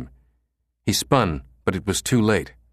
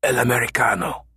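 strange choked voice; 4 semitones higher than usual. A narrator's reading. The killer questioning.

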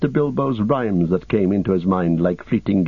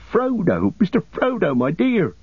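much higher, overjoyed cry of the name; tearful cry; 9 semitones higher than usual. Acted. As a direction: call out to him near tears. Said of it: "cried Sam, tears almost blinding him".

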